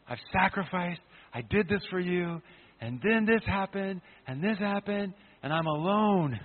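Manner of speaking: a touch of a wounded, self-pitying voice; forsaken believer. Acted. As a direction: plead like someone who gave everything and was left alone. Voicing a believer feeling forsaken.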